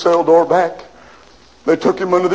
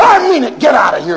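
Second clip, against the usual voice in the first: high, loud angry shout; angry refusal; 6 semitones higher, 4 dB louder than usual. Acted. Prisoner yelling at the governor to get out. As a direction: throw him out with a yell.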